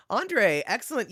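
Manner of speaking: ordinary talk, nothing marked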